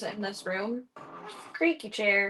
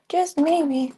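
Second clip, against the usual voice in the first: high singsong; 7 semitones higher, 6 dB louder than usual. Real, not acted. Repeated higher, louder.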